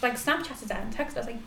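normal talking voice

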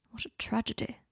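soft, dry murmur. A narrator's reading. Guardian reacting to the girl's excuse, murmured. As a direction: say it under your breath. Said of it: "murmured Draco".

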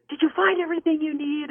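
high-pitched, seething stock question; fuming checker. Retold or imitated. Cashier after slamming down the phone, fuming. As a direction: repeat the routine question while fuming. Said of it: "she's just fuming".

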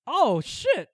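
high, loud burst of realization; mock sudden inspiration. Imagining a star struck by lyrics, mock excitement. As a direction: blurt it like an idea just hit.

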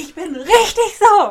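hammed-up high, drawn-out shriek; Anna screaming down the phone. Co-host re-enacts friend's angry phone call, mock screaming. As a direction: imitate a friend's furious yell, very loud. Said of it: "schreit mir ins Telefon".

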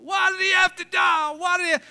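high, loud shouted lament; mock yelling. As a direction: wail it across the graveyard. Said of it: "he kept yelling".

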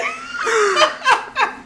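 very high, squealing laugh; helpless laugh. Real, not acted. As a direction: keep cackling at a high pitch. Cracking up at crude joke.

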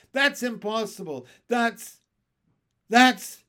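rising to a loud high disbelieving cry; astonished. Acted. Sponge watching the peach grow, sputtering and shocked.

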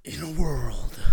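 loud, husky trailer narrator; trailer voice from the diaphragm. Shown as a demonstration. Host coached to breathe deep, trailer voice from the diaphragm. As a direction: push it out from deep down. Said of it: "The diaphragm".

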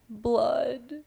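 dazed whisper-soft. Retold or imitated. Randy answering the cops in a soft, dazed voice. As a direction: say it like you are staring into nothing. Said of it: "he just goes".

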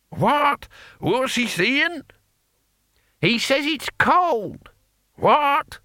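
very high, slow, strained querying; deaf old men. A narrator's reading. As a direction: squawk slowly as if hard of hearing. Deaf old men mishearing each other.

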